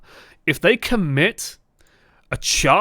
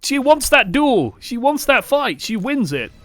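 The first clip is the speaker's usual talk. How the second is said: urgent warning; 8 semitones higher than usual. Real, not acted. Coach urging the support not to duel Widow, high and emphatic. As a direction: press the point hard.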